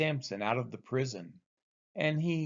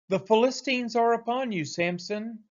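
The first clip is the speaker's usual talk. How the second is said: higher, louder urgent call of alarm; alarmed cry; 8 semitones higher, 3 dB louder than usual. Acted. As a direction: raise the alarm to wake him. Delilah alarms Samson a third time.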